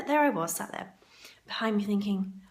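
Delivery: ordinary talk, nothing marked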